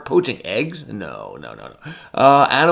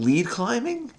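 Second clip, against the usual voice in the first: much higher, rising disbelieving question; wait, is that really it?; doubting; 10 semitones higher, 4 dB quieter than usual. Real, not acted. Checking her crossword answer, doubting.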